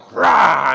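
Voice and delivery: loud drawn-out slow roar; the dragon attacks the crowd with a loud slow mock roar. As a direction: roar like a dragon breathing fire.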